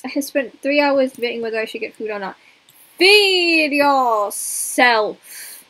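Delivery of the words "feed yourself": very slow, stern order; slow stern command. Pretend. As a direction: drag it out like an order. Reading chat command slowly.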